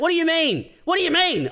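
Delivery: high-pitched indignant disbelief; high, insistent mock outrage; mock annoyed. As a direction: imitate an exasperated stranger demanding answers. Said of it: "they'd be, they'd be annoyed".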